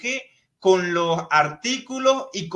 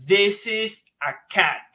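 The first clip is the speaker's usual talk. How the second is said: slow model sentence; 2 semitones higher, much slower than usual. Shown as a demonstration. Teacher modelling English for Spanish-speaking students, slow and careful. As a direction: spell out the English sentence slowly, word by word. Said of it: "Fíjense cómo aquí decimos".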